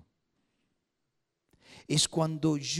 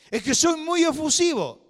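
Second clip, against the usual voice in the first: much higher, louder whiny self-excuse; mock excuse; 14 semitones higher, 8 dB louder than usual. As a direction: mimic a member defending his shouting. Mocking a churchgoer's excuse.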